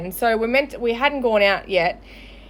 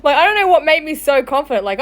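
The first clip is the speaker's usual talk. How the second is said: usual speaking voice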